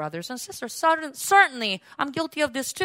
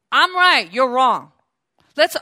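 smug know-it-all; 8 dB louder, slower than usual. Retold or imitated. Mocking the proud leader.

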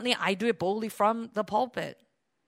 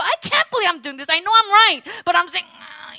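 grudging humility; 5 semitones higher, 6 dB louder than usual. Retold or imitated. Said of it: "crushing your ego".

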